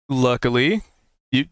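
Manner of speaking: normal talking voice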